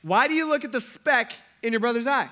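high, loud challenge to the crowd; loud rhetorical question. Shown as a demonstration. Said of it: "So, question".

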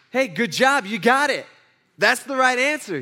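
hearty praise. Acted. Jesus telling the man he answered correctly, upbeat praise. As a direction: cheer them on for getting it right.

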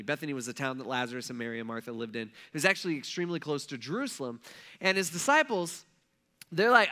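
ordinary talk

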